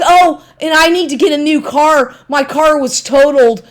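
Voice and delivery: high, loud whiny mock of him. Ex-boyfriend mocked as he brags about a new car, high whiny voice. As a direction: sneer at his bragging in a high whine. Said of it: "He's like".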